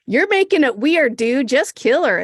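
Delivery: loud, exasperated telling-off; loud scolding. Real, not acted. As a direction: scold him loudly. Scolding the TV character.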